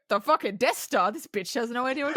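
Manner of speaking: laughing mock outrage of a Star Wars fan; mock angry fan. Imitating angry Star Wars fans mocking her, laughing. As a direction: play the scoffing fan through laughter. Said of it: "They're gonna go".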